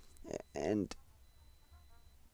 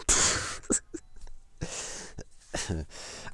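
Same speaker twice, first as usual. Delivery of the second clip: short embarrassed chuckle; self-conscious laugh; 4 dB louder than usual. Real, not acted. Host laughing at sounding insane, embarrassed chuckle. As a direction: laugh at yourself.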